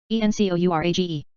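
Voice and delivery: quick letter run; brisk recap spelling; fast spelling. Shown as a demonstration. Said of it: "spelling drill, slow then fast".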